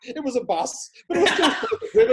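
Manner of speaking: laughing through the punchline; real laughter over the words. Real, not acted. Laughing at his own joke.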